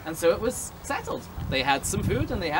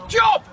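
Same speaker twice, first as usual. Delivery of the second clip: high, loud comic death yelp; strangled death cry; 12 semitones higher, 6 dB louder than usual. Acted. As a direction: squawk it like a murder victim. Horrible noise from upstairs.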